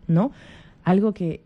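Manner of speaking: ordinary talk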